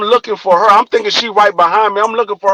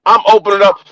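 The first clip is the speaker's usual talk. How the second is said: normal talking voice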